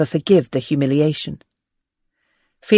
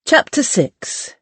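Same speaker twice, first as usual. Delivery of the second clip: chapter heading; 8 semitones higher, slower than usual. A narrator's reading.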